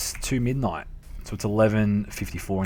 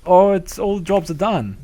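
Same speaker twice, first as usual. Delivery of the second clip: high, raised mock dad complaint; imitating dad; 10 semitones higher, 5 dB louder than usual. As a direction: play dad griping, starting loud. Voicing his dad's complaint.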